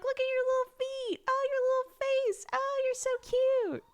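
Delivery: imitating her own high-pitched baby talk; gushing mom. Gushing mom cooing over her baby's feet and face, very high. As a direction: squeal adoringly, drawing out the last words. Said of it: "I'm such a mom. Every time I look at her".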